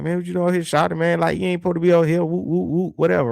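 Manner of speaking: put-on Southern kid voice. A kid telling another off his turf, fast and cocky. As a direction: play a brash Southern kid shooing someone off. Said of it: "that's how the kid talk".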